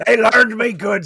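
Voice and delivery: laughing through the words; cracking up while repeating it. Real, not acted.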